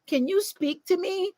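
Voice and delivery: very high, slow pleading; mock girlfriend on the phone; mock needy girlfriend. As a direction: stretch it out like a clingy call. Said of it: "She calls you".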